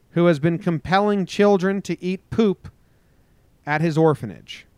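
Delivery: emphatic newscaster delivery; news headline read. Acted. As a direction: punch out each headline.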